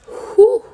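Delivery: high, loud exhale; acted deep breath. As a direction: blow out a deep steadying breath. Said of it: "So I took a deep breath".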